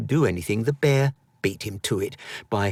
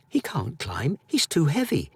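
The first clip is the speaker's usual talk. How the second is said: high, cheeky taunt; childish mocking boy; 5 semitones higher than usual. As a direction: tease the bear from safety. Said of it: "said Edwin, sticking out his tongue".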